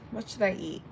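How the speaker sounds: ordinary talk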